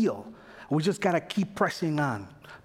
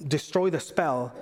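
usual speaking voice

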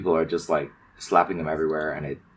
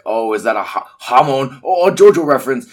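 excited fan; 6 semitones higher, 8 dB louder than usual. Retold or imitated. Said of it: "and you're like".

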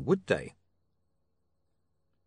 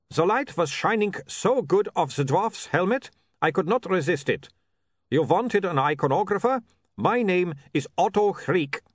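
a higher, louder voice with a hint of foreign lilt; lightly voiced foreign iconographer; 6 semitones higher, 4 dB louder than usual. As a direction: play the eager visiting photographer. Iconographer Otto introduces himself.

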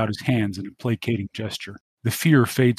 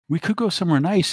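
whining; 8 semitones higher than usual. Acted. Dante pleading.

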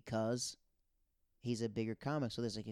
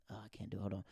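quiet; 3 semitones lower, 8 dB quieter, much faster than usual. Real, not acted. Quiet after failed singing.

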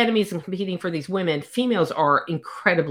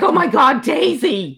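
high, louder cry of dismay; mock alarm; 8 semitones higher, 5 dB louder than usual. Readers reacting to Daisy being caught, mock alarm. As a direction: call out to her in playful horror.

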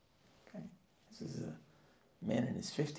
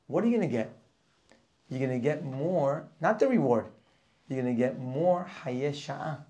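forceful preaching; 5 dB louder than usual. Real, not acted. Preaching the Rambam's lesson.

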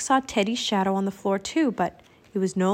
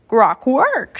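loud squawky bird syllables; raven croak; 3 semitones higher, 6 dB louder than usual. A narrator's reading. Annie answering Teddy in raven talk, croaking. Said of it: "she croaked".

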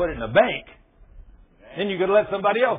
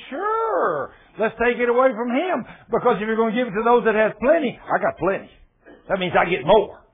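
greedy servant; 5 semitones higher, 6 dB louder than usual. Acted. Greedy servants voiced mockingly.

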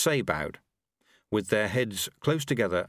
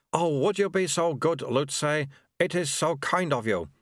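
high, fluttering relieved voice; fussy chief acolyte clutching at help; flustered official; 7 semitones higher than usual. Acted. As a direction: grab at the offer gratefully.

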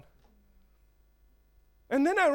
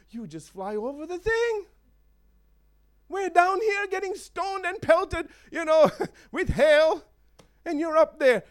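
put-upon grumbling, pitched up; mock complaining; 6 semitones higher than usual. Chickens moaning to the eagle flying above them, aggrieved.

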